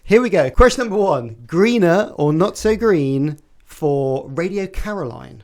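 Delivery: loud, bright game show announcing; quiz host voice. Acted. Host opening question one for the panel, loud quiz-show announcing.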